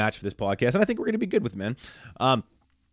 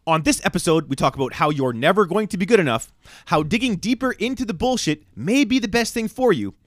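announcer read; 5 semitones higher than usual. Acted. Episode preview announcer read.

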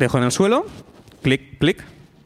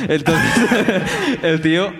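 ordinary talk, nothing marked

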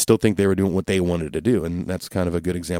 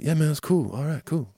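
higher, easygoing dude voice; laid-back bassist impression; 7 semitones higher, 5 dB quieter than usual. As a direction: toss it off like a chill bandmate. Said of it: "That's my new Sid impression".

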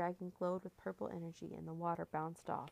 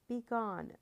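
higher, louder spell command; storyteller's forceful command; commanding cry; 4 semitones higher, 5 dB louder than usual. A narrator's reading. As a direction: order the enemy away. Wizard's command at an attacker.

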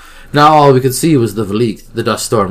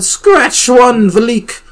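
high, excited victory call; jubilant cheer; 13 semitones higher, 6 dB louder, much slower than usual. A narrator's reading. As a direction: crow over the kill. Characters celebrate victory.

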